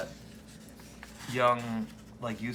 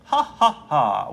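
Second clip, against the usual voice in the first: slow mocking laugh; 2 semitones higher, 9 dB louder, slower than usual. Mocking laugh read from comic.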